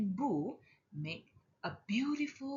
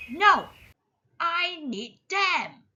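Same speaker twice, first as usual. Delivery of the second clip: high, loud protesting no; possessive child; 9 semitones higher, 10 dB louder than usual. A narrator's reading. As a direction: snap it back like a stubborn toddler, much louder. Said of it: "Sonny says".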